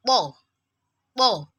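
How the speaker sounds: tongue tip on front teeth, strong "po"; thick, strong letter. Shown as a demonstration. Teacher contrasting it with letter ta, thick "po". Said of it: "because of the strength needed in producing its sound".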